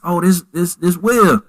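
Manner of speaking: high, excited discovery; light up like you just spotted a friend; delighted recognition. Retold or imitated. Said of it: "I said".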